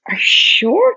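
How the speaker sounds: high, voiced, rising question; retold disbelief. To Diana about the match, high disbelief. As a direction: press the question with pitch shooting up.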